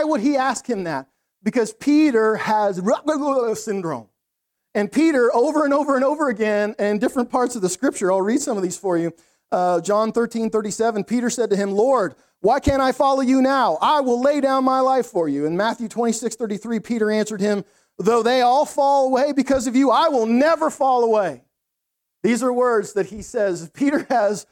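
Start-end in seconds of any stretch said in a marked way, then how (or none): 2.8-3.6 higher blabbering mouth noise; comic garbled babble. Pretend. Preacher naming Peter's mouthy syndrome, comic babble. 11.7-15.5 louder, higher, insistent declaration; bold vow. Retold or imitated. Preacher reading Peter's promise to Jesus, loud bold declaration. 18.0-21.4 loud, higher, emphatic pledge; bold vow. Retold or imitated. Preacher quoting Peter's pledge to Jesus, loud emphatic declaration.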